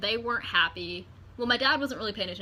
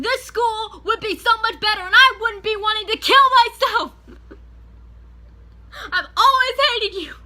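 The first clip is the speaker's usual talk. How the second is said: shrieking, much higher and louder, near tears; screaming at the principal; 12 semitones higher, 14 dB louder than usual. Retold or imitated. Student screaming at her principal, high and tearful. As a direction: scream it with a breaking, crying edge. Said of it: "I start yelling so loud".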